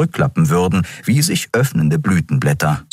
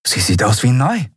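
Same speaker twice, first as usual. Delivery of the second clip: Endriel admiring; 7 semitones higher than usual. Acted.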